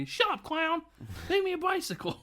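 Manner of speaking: put-on shrill-free bratty child shouting orders; bratty kid. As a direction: boss the clown around like a spoiled kid. Bratty kid demands balloon bicycle.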